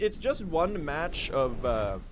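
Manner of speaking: ordinary delivery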